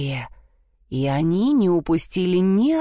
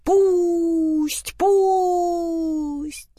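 roosters shouting; 10 semitones higher than usual. A narrator's reading. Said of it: "закричали петухи".

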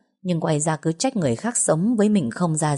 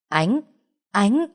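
calling a name, second much higher; 4 semitones higher than usual. A narrator's reading. Someone calling after Ánh as she wanders, calling out. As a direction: call after her from a distance.

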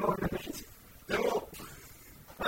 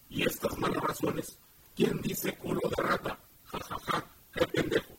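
computer voice. Acted. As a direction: talk like voice software. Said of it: "un software con la voz de Tony".

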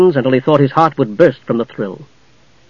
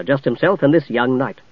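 ordinary delivery

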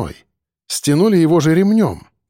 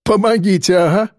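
mocking chuckle; 5 semitones higher than usual. A narrator's reading. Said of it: "хохотнул Рыжий".

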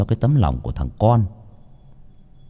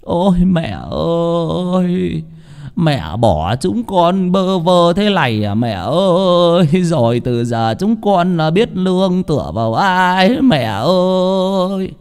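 higher, very slow wailing; whimpering lament; 8 semitones higher, much slower than usual. Acted. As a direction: drag out each "mẹ ơi" in grief. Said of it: "ngồi bên cạnh quan tài của bà cụ Tảo mà mếu máo". (mếu máo khóc than bên quan tài mẹ)

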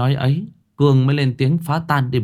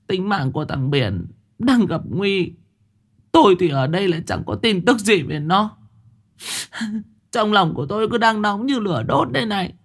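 higher, fretful worried mother; a mother burning with worry; anxious; 8 semitones higher than usual. Acted. Mother frets to the men that her son is in danger, anxious.

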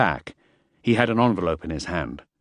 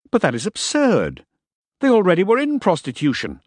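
high-pitched, incredulous objection; raised voice of outraged disbelief; indignant protest; 10 semitones higher, 3 dB louder than usual. A narrator's reading. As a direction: protest the absurdity. Protests an absurd charge.

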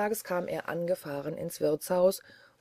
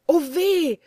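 sharp, much higher and louder yelp of pain; cry of pain; 10 semitones higher, 8 dB louder than usual. Acted. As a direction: yelp as your hand burns. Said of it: "schrie er". (hoher, lauter Schmerzensschrei)

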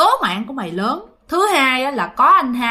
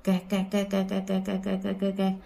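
put-on soft, low creak of an old door; 4 semitones lower, 10 dB quieter, faster than usual. Shown as a demonstration. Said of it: "tưởng tượng nó mở từ từ vậy nè".

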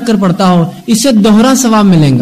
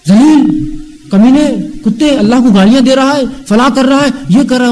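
angry scolding; 6 semitones higher than usual. Retold or imitated. Harsh tutor scolding a student.

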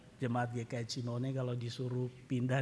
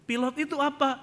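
high, louder, dismissive question; Pak Mukidi; 12 semitones higher, 6 dB louder than usual. Retold or imitated. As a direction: demand it as the indignant passenger. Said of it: "Pak Mukidi marah-marah". (bertanya meremehkan, nada tinggi)

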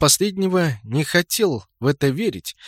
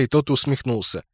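ordinary delivery

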